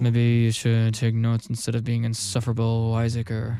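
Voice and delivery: low, slow sneering professor; Snape. Retold or imitated. Professor scolding a student in class, Snape impression. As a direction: drag it out low like a stern teacher. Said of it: "I'm pretty sure Snape said that".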